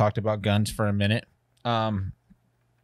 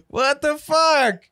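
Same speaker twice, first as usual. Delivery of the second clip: slow, much higher drawn-out disbelief; stunned disbelief; 13 semitones higher, much slower than usual. Real, not acted. Co-host reacts in slow stunned disbelief to the story. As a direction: let it stretch out in shock.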